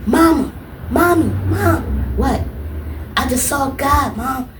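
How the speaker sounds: high, loud breathless calling for mom; high-pitched excited call to mom. Retold or imitated. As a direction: shout for mom in awe.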